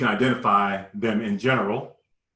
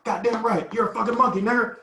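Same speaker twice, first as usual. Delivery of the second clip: going off on us; 10 semitones higher than usual. Retold or imitated. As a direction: scream the order at the boys. Gunman taunting.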